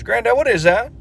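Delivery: put-on high child voice; little kid voice. Little Johnny asking grandpa, high childlike. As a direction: pipe up like a small boy.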